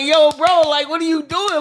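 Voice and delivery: high-pitched, lightly put-on New Yorker talk; New Yorker impression. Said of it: "he's talking just like a New Yorker".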